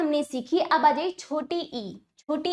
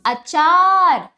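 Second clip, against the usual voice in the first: slow drawn-out demo; 7 dB louder, much slower than usual. Shown as a demonstration. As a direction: stretch the आ in अचार slowly and loudly. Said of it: "बोलिए अचार". (आ को खींचकर धीरे-धीरे ज़ोर से अचार)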